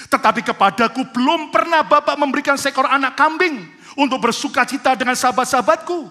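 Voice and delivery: loud, high, driving sermon delivery; full-force preaching. Acted. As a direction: preach it with rising fire to the congregation. Preaching the son's complaint.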